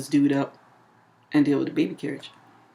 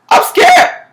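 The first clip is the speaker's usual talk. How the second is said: very high, loud squeal of fear; hammed-up panicked scream; mock terrified yell; 10 dB louder than usual. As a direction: imitate a man shrieking in fright. Repeats frightened man's cry.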